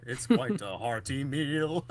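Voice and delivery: slow, drawn-out jingle imitation; amused, hammed-up slow recital; quoted commercial song line. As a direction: stretch it out like a kids' TV jingle. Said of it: "one of the lines was".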